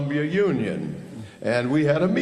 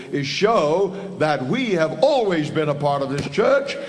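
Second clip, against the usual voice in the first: preaching; 5 semitones higher than usual. Acted. Speaker rallies the brethren with rising preaching. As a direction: build to a rousing sermon climax.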